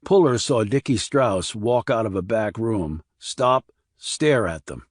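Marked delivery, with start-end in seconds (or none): none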